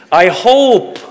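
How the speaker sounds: hopeful fan. Retold or imitated. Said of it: "how many Leaf fans are here".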